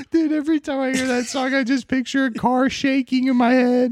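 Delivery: high, laughing through the words; giggly high voice. Real, not acted. Laughing high after joke.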